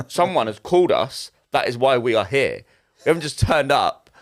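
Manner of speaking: slow, deliberate, spelled out word by word; indignant retort. Retold or imitated. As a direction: retell it as a pointed, measured reply. Retelling his indignant retort to priest.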